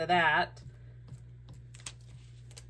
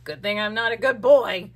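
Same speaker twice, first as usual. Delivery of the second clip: smug raccoon; 3 semitones higher, 7 dB louder than usual. Pretend. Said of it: "the raccoon's going".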